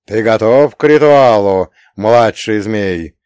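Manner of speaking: slow, loud, solemn ritual voice; solemn question. A narrator's reading. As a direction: intone it like a ritual. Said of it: "торжественно вопросил ящер".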